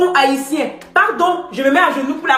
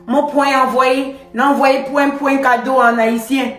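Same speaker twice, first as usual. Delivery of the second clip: lightly put-on Haitian Creole accent, slower; imitating Haitian accent. Mimicking the Haitian candidate to the live audience, Haitian accent. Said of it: "La candidate haïtienne était là comme".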